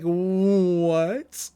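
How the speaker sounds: long drawn-out rising "what"; stunned. Retold or imitated. Whole club reacting to a sudden confession, stunned. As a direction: stretch it out in mock disbelief.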